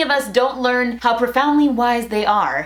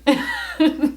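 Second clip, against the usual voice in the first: short higher-pitched chuckle; light laugh; 5 semitones higher than usual. Real, not acted. Vlogger amused by the windshield washer bit, light laugh. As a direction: laugh it off briefly. Laughs after mock protest.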